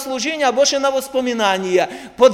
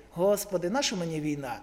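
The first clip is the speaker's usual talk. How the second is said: quiet musing; 5 semitones lower, 12 dB quieter than usual. Retold or imitated. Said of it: "дивиться".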